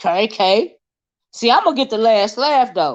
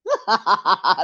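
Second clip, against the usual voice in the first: short burst of real laughter; amused falling laugh. Real, not acted. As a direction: laugh at your own teasing. Laughing at own trash talk.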